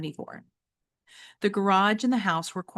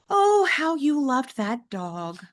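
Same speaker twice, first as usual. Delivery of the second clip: slow, higher, fond mother; a hint of nostalgia; wistful mom; 7 semitones higher, 4 dB louder, slower than usual. Mother reminding James of his dog, slow and fond.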